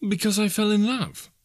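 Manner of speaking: put-on high-pitched, earnest young man; earnest nephew. A narrator's reading. Nephew explaining his marriage to Scrooge, earnest. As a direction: answer with open-hearted simplicity.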